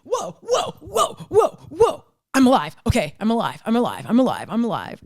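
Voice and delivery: shocked whoa settling into relief; stunned relief. Retold or imitated. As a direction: voice the body realizing it survived. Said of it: "your body being like".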